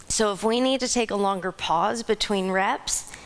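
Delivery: usual speaking voice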